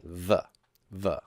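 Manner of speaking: "V" voiced as a short "vuh"; German V sound. Shown as a demonstration. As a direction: say the sound "vuh", not the letter name "V". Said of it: "pronounces W's like a V sound".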